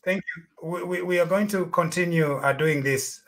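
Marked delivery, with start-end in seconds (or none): none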